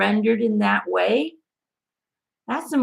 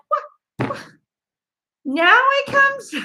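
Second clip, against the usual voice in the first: amazed surprise; 4 dB louder, slower than usual. Retold or imitated. Surprised when validation arrives.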